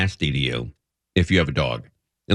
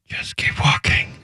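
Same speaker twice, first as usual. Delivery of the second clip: hushed, unvoiced whisper; imitated wife's whisper. Wife to husband walking past the cops, whispering. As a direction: whisper it under your breath, as the wife. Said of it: "my wife whispers".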